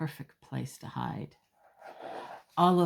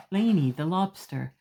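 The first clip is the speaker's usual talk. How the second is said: ordinary delivery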